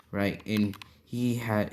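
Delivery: ordinary delivery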